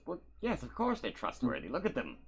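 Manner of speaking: Reggie voice. Acted. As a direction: puff up the praise.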